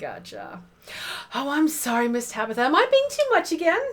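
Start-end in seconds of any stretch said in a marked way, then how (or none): 1.3-3.8 apologetic Midwest mom, jumping high and fast at the end. Acted. Janie apologises to Tabitha in a fretful Midwest mom voice. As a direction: fret like a flustered Midwest mom. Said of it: "Midwest mom voice".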